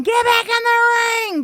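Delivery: high, loud imitated yell; yelling referee. Referee to wrestlers fighting on the floor, imitated yelling. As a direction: holler it high like an exasperated ref. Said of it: "The ref is yelling".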